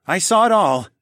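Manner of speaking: near tears, voice lifting; a father fighting back tears; moved father. A narrator's reading. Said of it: "tears threatened to fall".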